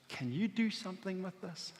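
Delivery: very soft, hushed question; humble plea. Acted. Little kid who had basically nothing, humble and hushed. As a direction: imitate a child's quiet asking.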